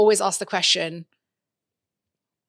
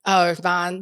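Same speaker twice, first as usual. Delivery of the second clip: normal talking voice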